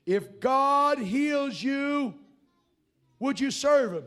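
loud, slow, drawn-out yell. Retold or imitated. Preacher yelling a question at a deaf man, loud and slow. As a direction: shout it so a nearly deaf man can hear. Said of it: "I'm yelling at him".

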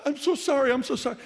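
high, loud, frantic apology; a scared kid pleading sorry; panicked apology. Retold or imitated. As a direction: blurt it out in a panic. Boy caught by his dad.

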